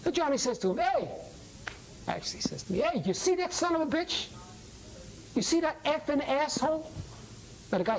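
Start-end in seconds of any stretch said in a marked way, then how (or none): 0.8-1.1 calling out. Acted. Johnny calls out to the man. 2.8-6.9 high-pitched, confrontational callout; acted indignant taunt. Johnny loudly insults a passing man.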